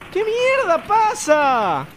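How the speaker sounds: high, slow, drawn-out disbelief; stretched-out shocked question; stunned outcry. Real, not acted. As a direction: wail it at the screen. Surprised by something in game.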